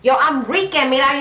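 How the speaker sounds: put-on proud Rican girl. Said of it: "she was like".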